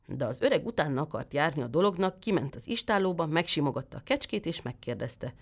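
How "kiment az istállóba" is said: usual speaking voice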